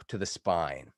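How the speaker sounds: ordinary talk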